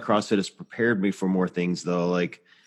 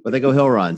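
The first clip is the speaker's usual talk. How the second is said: hill run said louder, with hill punched; stress on hill; 4 semitones higher, 6 dB louder than usual. Retold or imitated. Mocking the hosts' "hill run", louder, leaning on hill. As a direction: lean hard on the word hill, mocking it. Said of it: "I'm gonna emphasize the word hill".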